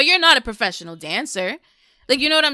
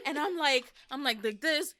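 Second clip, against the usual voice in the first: ordinary delivery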